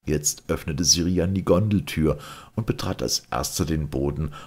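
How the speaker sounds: ordinary delivery, nothing marked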